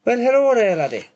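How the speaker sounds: high, loud Scottish greeting swooping down; exaggerated Scottish accent, high and loud; mock Scottish accent. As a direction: call out in a playful high Scots voice. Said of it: "taking the piss out of Scottish".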